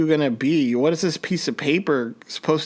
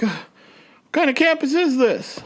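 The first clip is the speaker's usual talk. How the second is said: much higher, incredulous question; retold exasperation; 11 semitones higher than usual. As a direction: sound baffled and fed up. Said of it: "I was like".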